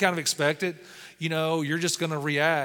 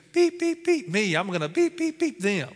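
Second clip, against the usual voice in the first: mock censor beeps; 6 semitones higher than usual. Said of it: "going by going beep, beep, beep".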